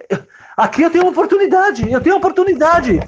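high, loud, eager cry; excited realization. Retold or imitated. Husband realizing he has found his blessing. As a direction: burst out with sudden hope.

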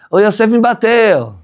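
put-on high, slow child tattling; whiny child. Child telling father brother hit him, high whine. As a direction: complain like a little kid.

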